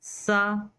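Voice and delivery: सा said light and gentle; lisp-soft सा, tongue relaxed; Arabic tha soft. Shown as a demonstration. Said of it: "हल्का सा नर्म लहजे में सा".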